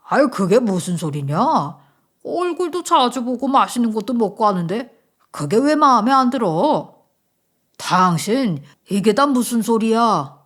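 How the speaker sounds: forceful, gruff-spoken father-in-law; startled husband. Acted. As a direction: demand to know what is going on. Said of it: "깜짝 놀란 남편은 무슨 일이냐고". (놀라서 따져 묻는 시아버지)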